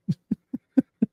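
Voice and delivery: short giggle up high; light amused chuckle; high breathy laugh. Real, not acted. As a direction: laugh at your own joke. Laughs after own joke.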